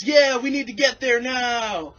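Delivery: high, loud slow mock-entitled voice; drawn-out demanding upper-class voice; upper-class passenger demanding the bus move, high and slow; posh tourist.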